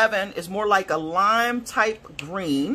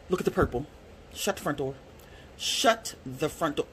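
soft, stunned murmur; hushed disbelief; 6 semitones lower, 8 dB quieter than usual. Real, not acted. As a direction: breathe it out in disbelief. Stunned by purple.

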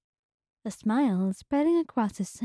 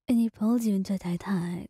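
ordinary delivery

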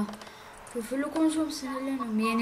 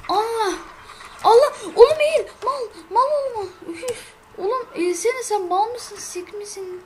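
very high, loud screaming at a teammate; yelling at a teammate to duck; furious yell; 10 semitones higher, 7 dB louder than usual. Real, not acted. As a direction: scream orders in exasperation.

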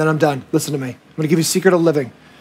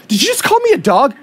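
mock indignation; 6 semitones higher than usual. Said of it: "She doesn't go".

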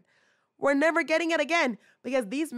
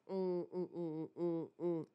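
very soft, low pulsed humming; hushed mock sound-effect hums; imitated low hum; 5 semitones lower, 12 dB quieter, slower than usual. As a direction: hum a quiet run of short "mm"s. Imitates doors opening for the meeting.

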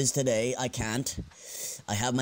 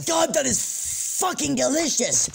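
ecstatic; 4 dB louder than usual. Acted. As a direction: savour every word like it's the best bite ever. Tasting pizza ecstatically.

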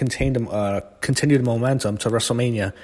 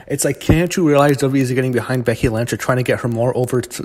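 ordinary talk, nothing marked

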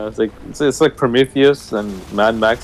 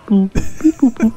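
higher, playful beep boop noises; robot beeping; 11 semitones higher, much slower than usual. Retold or imitated. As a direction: copy the game's robot chirps. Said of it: "Oh, yeah, beep".

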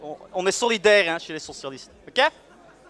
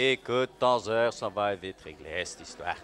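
low and much softer, a menacing murmur; low menacing threat; 13 semitones lower, 10 dB quieter than usual. Acted. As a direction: lean in and threaten him quietly. Catchphrase repeated.

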